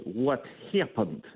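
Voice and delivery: slow, put-on Russian accent; playful Russian accent for a laugh. Shown as a demonstration. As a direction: drag out the words in a mock Russian accent. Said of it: "in my Russian accent".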